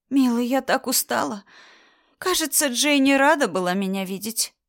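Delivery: high, cloying whine; a sugary, grating wife voice; whiny wife. Acted. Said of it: "Противный голос Анны".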